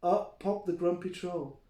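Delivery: usual speaking voice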